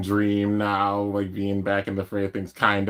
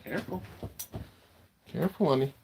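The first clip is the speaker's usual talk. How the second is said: tender to dog; 6 semitones higher, 5 dB quieter, faster than usual. Real, not acted. Talking to dog, high soft.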